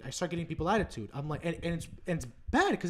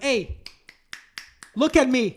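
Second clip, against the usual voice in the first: calling sister out; 8 semitones higher, 10 dB louder than usual. Retold or imitated. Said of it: "I'll be like".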